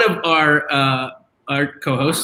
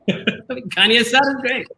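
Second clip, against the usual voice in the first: laughing whisper; 6 semitones higher than usual. Real, not acted.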